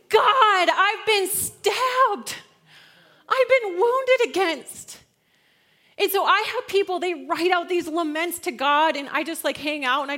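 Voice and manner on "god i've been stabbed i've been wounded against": loud, high, slow wail to God; hammed-up anguished outcry; crying out lament. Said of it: "and you say".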